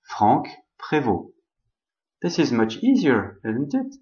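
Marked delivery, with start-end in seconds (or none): 0.0-1.3 "Franck Prévost" slowly, the French way; French pronunciation. Shown as a demonstration. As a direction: say "Franck Prévost" with throaty French Rs and silent final st. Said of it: "just say".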